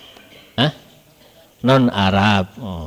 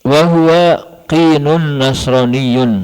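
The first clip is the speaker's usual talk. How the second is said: slow, raised recitation of the Arabic line; text recitation; 4 semitones higher, 5 dB louder, slower than usual. Real, not acted. Teacher reads the Arabic text to students, slow and raised. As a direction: read out the kitab text with weight.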